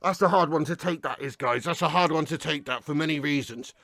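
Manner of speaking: a beaten fan quietly admitting defeat; gutted and quiet. Real, not acted. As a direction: let it sink in, barely above a murmur. Dejected after the late defeat.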